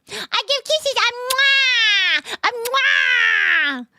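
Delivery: high loud squeaky kiss voice; high cute anime girl. Acted. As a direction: blow kisses in a cute squeal. Blowing kisses in cute voice.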